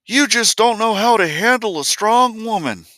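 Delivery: high, far-off mock voice of a critic; mocking quote. Speaker mocks people lecturing him, in a high mocking voice. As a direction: sneer it back in a silly high voice. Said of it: "talk to me about".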